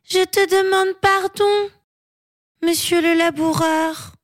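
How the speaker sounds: put-on high-pitched child apologising; sorry child, pitched high; penitent giant girl. A narrator's reading.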